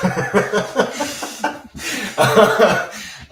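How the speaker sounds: high, loud laugh; real high-pitched laugh; burst of laughter. Real, not acted. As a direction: laugh heartily at your own joke. Laughs at own therapist joke.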